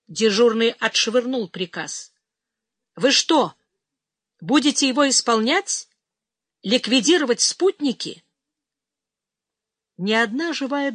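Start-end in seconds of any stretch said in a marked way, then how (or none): 2.9-8.2 loud, higher outraged questions; indignant shout. A narrator's reading. Duty officer confronts his chief over the order, loud and outraged. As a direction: hurl each question at him.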